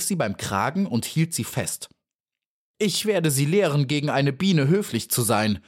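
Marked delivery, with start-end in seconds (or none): 2.8-5.6 indignant, raised pitch; wrathful telling-off, not shouted; angry cry. A narrator's reading. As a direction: scold as a threat, voice lifted. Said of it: "rief sie".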